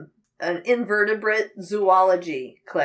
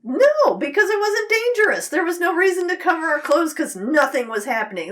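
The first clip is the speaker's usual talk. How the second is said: high, loud, insistent no; animated denial, climbing high; emphatic no; 9 semitones higher, 3 dB louder than usual. Real, not acted. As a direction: shoot the idea down with gusto. Emphatic denial of wearing a lab coat.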